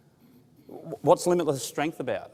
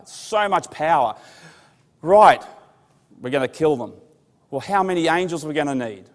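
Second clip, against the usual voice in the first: loud, driving sermon voice, pitched up; fiery preaching; 2 semitones higher, 7 dB louder than usual. Acted. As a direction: preach it out to the hall with rising fire. Preaching at full force.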